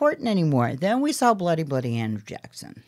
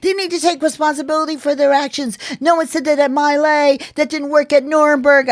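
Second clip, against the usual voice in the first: mock shout; 8 semitones higher, 4 dB louder than usual. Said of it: "they were like".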